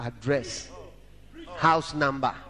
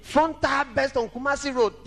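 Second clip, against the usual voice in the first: raised emphatic retelling; higher, emphatic repeat; 9 semitones higher than usual. Shown as a demonstration. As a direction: lift it higher the second time. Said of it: "repeats the line".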